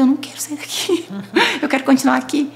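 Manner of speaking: high, laughing whine of refusal; laughing protest. Retold or imitated. Telling how she refused to move out, laughing whine. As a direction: plead not to leave while laughing.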